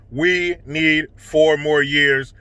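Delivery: ordinary talk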